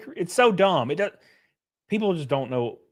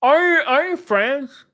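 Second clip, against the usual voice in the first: much higher, slow mocking voice; mock dopey asker; 10 semitones higher, 4 dB louder, slower than usual. Streamer re-mocking the stranger, high and slow. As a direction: drag it out like the lost stranger.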